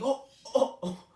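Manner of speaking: high, loud startled oh-oh-oh; shocked oh. Retold or imitated. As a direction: caught off guard, it bursts out. Realizing the boy is racist.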